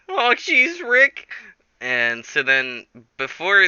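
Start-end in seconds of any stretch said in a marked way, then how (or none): 0.1-1.3 very high cartoon boy impression; Morty. Morty gives in to Rick, squeaky Morty impression. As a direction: squeak out a fretful protest. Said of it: "And Morty's like".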